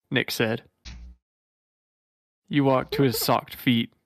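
ordinary talk

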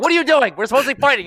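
loud, high urgent yell; yelling at a friend. Retold or imitated. Yelling at distracted teammate in game, loud and high. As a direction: snap him back into the fight.

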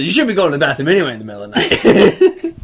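fast, teasing, through laughter; laughing. Retold or imitated. Husband teasing his wife about the bathroom, laughing. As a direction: blame her while laughing. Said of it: "I told Grace, I said".

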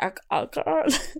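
high, slow, choked attempt to speak; mouth barely opening. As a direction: imitate talking with a jaw that won't open. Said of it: "I couldn't open my mouth".

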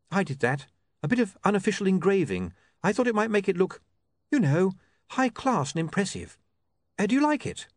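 high, tentative explanation; shy proud woman reporter. Acted. Saccharissa owning up to her engraving, shy. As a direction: confess your handiwork hopefully.

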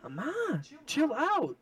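high, drawn-out protest to mom; acted exasperated son. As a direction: plead loudly, imitating a fed-up kid. Acts out pleading back to mom.

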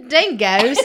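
slow, drawn-out mock Australian accent; amused, exaggerated Australian-British accent; attempted Australian accent. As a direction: stretch it out in a bad Aussie accent that sounds British. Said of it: "doing a hard British there".

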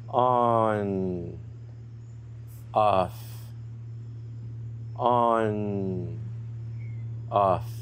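long drawn-out "on", short "off", gradually quickening; steady on-off chant with shrinking gaps; slow rhythm, speeding up. Shown as a demonstration. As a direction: count like a metronome that gradually speeds up. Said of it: "we're gonna increase the rhythm".

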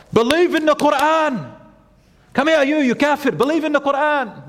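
high-pitched haranguing, hammed-up; mock aggressive preaching. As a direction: badger passers-by in an imitated shouty call. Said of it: "we don't talk like that".

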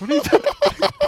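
high, fast, bewildered mom voice; mom's quick, high disbelief; baffled mom. Retold or imitated. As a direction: squeak it out like a confused mother.

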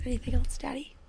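soft whispered question; breathy whisper aside. Real, not acted.

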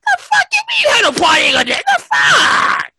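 high, shouted Brooklyn outrage; hammed-up Brooklyn yell, pitch way up. DJ acts out his angry DM to the promoter in a Brooklyn voice. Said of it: "This is his Brooklyn voice".

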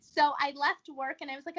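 usual speaking voice

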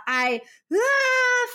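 tantrum wail. Retold or imitated. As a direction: burst into a mock tantrum cry. Imitating son's tantrum cry.

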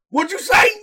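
loud, high offended comeback; mock outrage. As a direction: play someone loudly challenging an insult. Acting out offended person's comeback.

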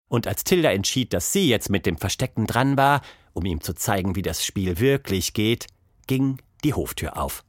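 plain reading voice

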